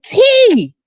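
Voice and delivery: high, drawn-out what-are-you-doing; high slow alarmed question; startled call. Retold or imitated. Aunt catches nephew rubbing lotion.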